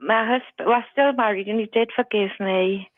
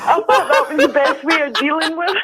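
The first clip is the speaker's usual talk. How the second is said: loud, higher voice through laughter; real amused laughter in the words; laughing; 6 semitones higher, 6 dB louder than usual. Real, not acted. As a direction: say it while cracking up. Caller laughing at own revenge.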